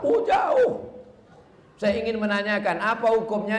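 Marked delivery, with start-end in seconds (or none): 0.0-0.9 high, loud mock gasp of amazement; mock surprise. Preacher teasing the Jakarta questioner, mock surprise. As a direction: squeal it like you're impressed by the distance. Mocking reply to a far-off questioner.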